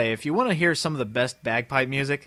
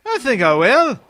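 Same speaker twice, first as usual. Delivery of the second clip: Irish voice; 5 semitones higher, 6 dB louder than usual. Acted. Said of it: "I learned my Irish voice from Lucky".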